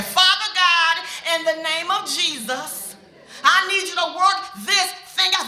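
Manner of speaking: loud, pleading prayer; mother loudly praying for her son; urgent prayer. Retold or imitated. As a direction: cry out to God.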